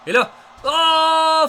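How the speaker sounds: high, slow, loud "oh"; drawn-out excited call. Real, not acted. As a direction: stretch the call with awe.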